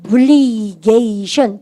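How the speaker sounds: "obligation" stretched very slowly, syllable by syllable; drawn-out syllables. Shown as a demonstration. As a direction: spell out "obligation" by sound, each syllable held long. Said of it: "영어 스펠링 한번 볼까요?". ("obligation"을 음절마다 아주 길게 늘여서)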